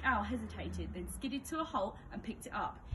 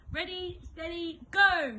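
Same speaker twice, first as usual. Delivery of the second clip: slow then a high, loud shriek on go; 8 semitones higher, 9 dB louder, slower than usual. A narrator's reading. Teacher starting the race, high loud shriek. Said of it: "shrieked Miss Battleaxe".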